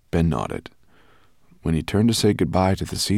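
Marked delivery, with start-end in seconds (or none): none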